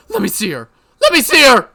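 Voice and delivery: high, loud crying shouts; desperate plea. Acted. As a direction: beg through tears. Mion begs to see Rika.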